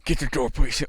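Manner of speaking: big breath, then a strained grumble; powering up to speak. Retold or imitated. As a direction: imitate someone short of lung capacity. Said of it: "he had to power up to use it".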